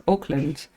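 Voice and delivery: "Auckland" with a rounded OAK first syllable; local pronunciation. Shown as a demonstration. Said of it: "like they pronounce Auckland".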